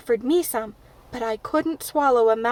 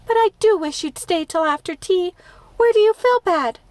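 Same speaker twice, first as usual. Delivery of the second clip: anxious pleading; 6 semitones higher, 3 dB louder than usual. A narrator's reading.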